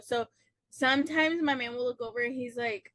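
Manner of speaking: ordinary delivery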